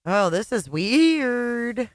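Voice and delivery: usual speaking voice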